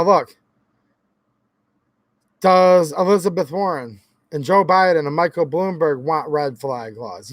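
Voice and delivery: ordinary delivery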